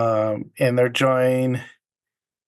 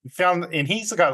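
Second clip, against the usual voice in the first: usual speaking voice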